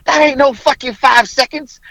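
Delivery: high, loud indignant retort; worked-up heckling, acted out; angry outburst. Retelling an angry spectator's outburst.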